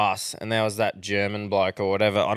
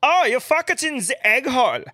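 put-on high, broken-English accent, lightly louder; foreign bloke's accent; 13 semitones higher, 4 dB louder than usual. Foreign bloke on the bus explaining chooks in broken-English accent. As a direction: play a foreign bloke whose English isn't the best. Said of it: "English wasn't the best".

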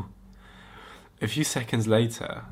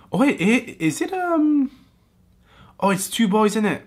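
high, loud excited discovery; excited realization; 12 semitones higher, 6 dB louder than usual. Real, not acted. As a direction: gasp with delight at the twist. Realizing it's two boys.

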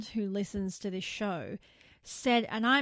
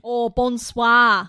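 put-on much louder, slow French accent; mock French; 2 semitones higher, 13 dB louder, much slower than usual. Host imagining a bogan French person in a loud French accent. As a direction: croon it like a cartoon Frenchman. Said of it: "that's what I imagine".

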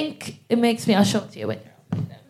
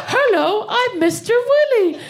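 put-on high, silly kids' show voice; children's TV character; 11 semitones higher than usual. Comic playing a character on a children's TV show, high mock-cheery voice. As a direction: greet the little viewers in a high sing-out. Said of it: "On a, on a children's T V show".

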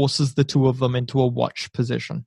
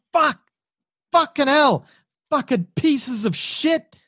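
pained cursing; 9 semitones higher than usual. Acted. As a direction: swear at the enemy while bleeding. Wounded soldier curses in pain.

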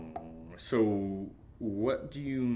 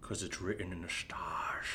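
mock-ominous whisper; mystical whisper; 3 semitones lower, 9 dB quieter than usual. Roommate answers his friend in a mock-prophetic whisper. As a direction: whisper it like fate itself.

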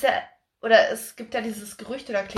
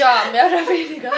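laughing; 5 semitones higher, 8 dB louder than usual. Real, not acted. Ironic agreement about the father.

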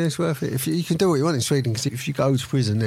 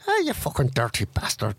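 put-on Irish accent; 2 semitones lower than usual. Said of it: "the only one I know is like that".

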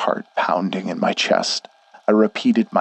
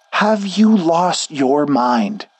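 higher, slow, panting outburst; breathless shout; 8 semitones higher, much slower than usual. Acted. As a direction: yell it while gasping for air. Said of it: "he shouted in between soft gasps".

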